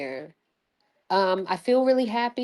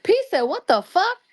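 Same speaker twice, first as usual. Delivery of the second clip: amused; 11 semitones higher, 4 dB louder than usual. Real, not acted. Reading viewer comment.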